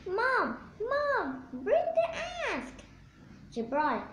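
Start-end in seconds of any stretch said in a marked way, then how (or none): 0.1-2.7 high, laughing mock shout; shouting for Mom. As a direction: call for Mom through a giggle, rising higher. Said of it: "I shouted".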